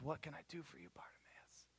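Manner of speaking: very soft, hushed acted question; gentle whisper. Jesus asking the blind man what he wants, gentle whisper. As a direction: whisper it tenderly after the uproar.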